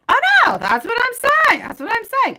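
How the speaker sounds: high, loud "oh no, that's what I'm saying"; excited agreement. Real, not acted. Reader eagerly agreeing with viewers in chat, high excited exclaim.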